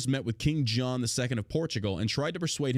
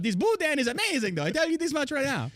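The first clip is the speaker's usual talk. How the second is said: much higher, hammed-up enthusiasm; high hammed-up food lover; mock Cajun fan; 13 semitones higher than usual. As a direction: rave about the food in a put-on voice. Voicing Columbus in Louisiana.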